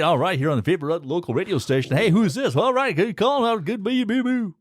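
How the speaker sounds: high, hammed-up local radio DJ patter; cheesy radio DJ. Shown as a demonstration. Radio man parodying a local station show, cheesy DJ voice. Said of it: "I kinda get that cheesy thing going on".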